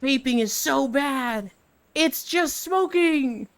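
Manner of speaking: high, slow parody of a scare commercial; mock anti-vaping ad. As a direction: stretch it out as an overdramatic PSA. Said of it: "truth commercials that are like".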